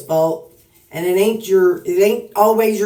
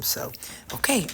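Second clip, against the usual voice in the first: ordinary talk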